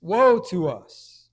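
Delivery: loud, slow drawn-out wail of woe; lament. Retold or imitated. Jeremiah crying out over his plundered people, loud slow lament. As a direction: cry it out like a grieving prophet. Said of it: "Jeremiah says".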